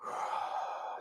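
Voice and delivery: slow audible exhale; long breath out. Shown as a demonstration. Teacher demonstrating how to calm down, exhaling. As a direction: let the air go slowly. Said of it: "take a long, deep breath".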